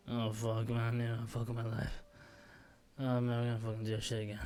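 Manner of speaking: low, soft, just-woke-up groan; groggy waking. Shown as a demonstration. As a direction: play it barely awake. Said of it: "I'll just wake up like this".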